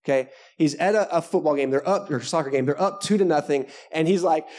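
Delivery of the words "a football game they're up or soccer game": usual speaking voice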